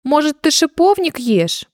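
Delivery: tentative, baffled question; puzzled. A narrator's reading. Rabbit offers the baby rosehips, puzzled. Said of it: "озадаченно спросил кролик".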